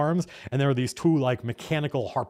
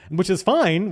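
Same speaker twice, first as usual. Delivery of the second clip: ordinary talk, nothing marked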